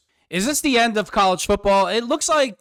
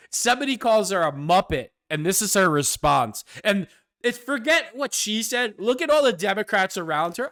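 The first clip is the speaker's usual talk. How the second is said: ordinary delivery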